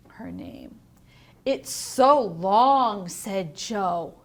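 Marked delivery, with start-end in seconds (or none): 0.1-0.8 whispered question; soft whispered prompt. Shown as a demonstration. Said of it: "teacher prompting thinking". 1.4-3.1 slow, loud mocking drawl; a classmate taunting slowly; teasing. Kids teasing Chrysanthemum.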